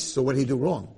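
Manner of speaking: ordinary talk, nothing marked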